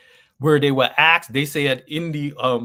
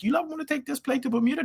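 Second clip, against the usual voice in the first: high voice; 13 semitones higher, 3 dB quieter, faster than usual. Retold or imitated. Retelling pitch to company.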